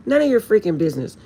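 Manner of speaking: sassy retort. Retold or imitated. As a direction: shut down a nosy sister fast. Acted retort to nosy sister.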